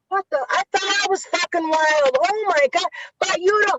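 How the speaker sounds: high-pitched New York impression; Carmine's New York accent. Retelling a friend's stunned messages, high mock New York accent. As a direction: mimic a worked-up New Yorker in a high voice. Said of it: "Carmine's going".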